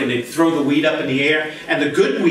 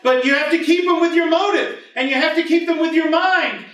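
usual speaking voice

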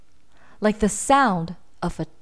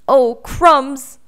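gulping fear; 5 semitones higher, 9 dB louder than usual. A narrator's reading. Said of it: "gulped the elephant".

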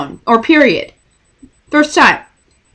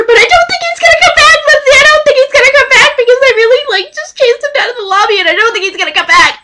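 very high, fast panicked whine; hammed-up fangirl panic; freaking out; 11 semitones higher than usual. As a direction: rattle out the worry in a squeaky rush. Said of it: "freaking out on the phone".

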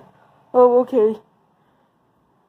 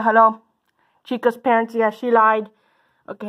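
normal talking voice

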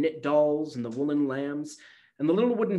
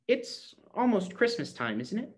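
put-on little girl asking slowly and high; slow child voice; 7 semitones higher, 4 dB quieter than usual. A narrator's reading. Gretchen slowly asks Granny about Christmas, high and slow. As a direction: draw the question out, hopeful. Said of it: "said Gretchen slowly".

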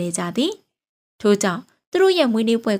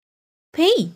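put-on high, louder childlike call of father; child calling dad; 9 semitones higher, 4 dB louder, much slower than usual. Little girl calls her father at the dinner table, high and eager.

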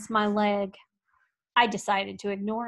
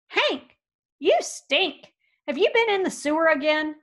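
much higher, louder, grossed out; disgusted scolding; 5 semitones higher, 4 dB louder than usual. Acted. Rancher recoiling from his stinking dog, high and loud. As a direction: wrinkle your nose at the dog. Loper greets smelly dog.

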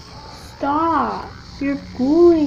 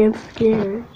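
ordinary talk, nothing marked

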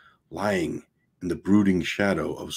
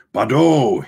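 a loud, higher snapped name; sharp snapped call; 5 semitones higher, 8 dB louder than usual. A narrator's reading. The captain warns the sailors of the natives with a loud snap. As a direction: bark the name out as a warning. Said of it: "he snapped".

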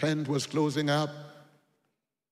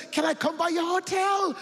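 high, louder eager ask; eager question; 12 semitones higher, 5 dB louder than usual. Retold or imitated. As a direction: push the request. Eager millionaire asks.

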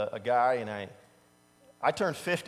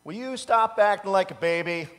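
higher, louder exasperated scold; 5 semitones higher, 8 dB louder than usual. Retold or imitated. Preacher voicing the urge to scold someone, exasperated and louder. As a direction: snap at someone acting childish. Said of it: "the urge to tell somebody".

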